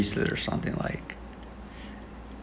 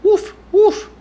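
very high, yappy puppy voice; squeaky dog voice. Pretend. Vlogger in a dog filter begs for an owner, squeaky high. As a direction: yip like a tiny cartoon puppy. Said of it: "Jill the dog".

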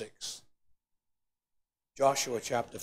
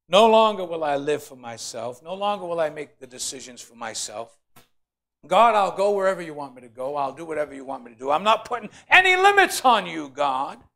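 loud fervent prayer cry; preaching at full force; 9 dB louder than usual. Acted. Preacher voicing a surrender prayer to God, loud.

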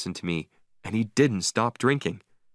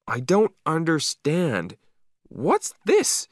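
slow, higher bewildered voice; puzzled dog; 7 semitones higher, slower than usual. A narrator's reading. Dog meeting snow for the first time, puzzled. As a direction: wonder aloud like a baffled dog, pitch lifted. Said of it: "I could read his thoughts".

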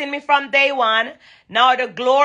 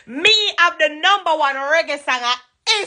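triumphant brag; 5 semitones higher than usual. Real, not acted.

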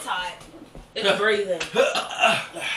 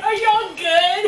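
slow, high and loud through laughter; cracking up so hard it sounds like crying; laughing to tears; 10 semitones higher, 6 dB louder, much slower than usual. Real, not acted. Laughing and teary.

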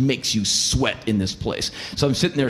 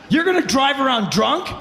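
incredulous question; 11 semitones higher than usual. Retold or imitated. Shocked at drunk drivers.

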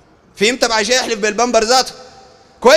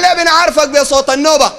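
higher, louder acted questioning; raised voice of puzzled followers; followers' question; 4 semitones higher, 5 dB louder than usual. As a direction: call the question up to the sheikh. Acts followers questioning their sheikh.

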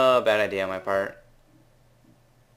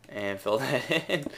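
ordinary delivery

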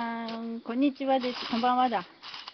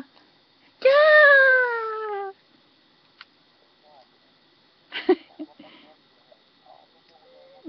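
high, loud shriek on one long breath; a shriek of mock fright, drawn out; playful scream; 11 semitones higher, 7 dB louder, much slower than usual. Showing her mom the creepy figure, a loud mock shriek. Shows mom a scary build.

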